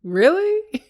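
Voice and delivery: imitating a coworker's high, loud "really?"; mock surprise. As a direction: squeak it out in fake disbelief. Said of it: "looked at their watch and was like".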